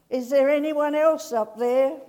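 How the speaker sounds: loud high shout upward; calling out. Acted. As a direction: call out to anyone else above. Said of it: "then he calls out".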